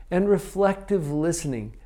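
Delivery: ordinary delivery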